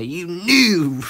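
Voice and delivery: high, loud mock jeer; angry accusation imitated. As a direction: imitate friends yelling an accusation. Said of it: "they got angry at me".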